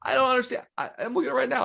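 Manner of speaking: high, incredulous squeak; can't believe what he's reading; disbelief. Real, not acted. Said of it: "disbelief at coincidence".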